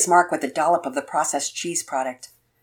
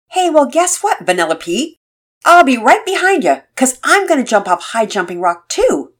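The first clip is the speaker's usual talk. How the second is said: confident; 8 semitones higher, 8 dB louder than usual. A narrator's reading. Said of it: "Todd sounded confident".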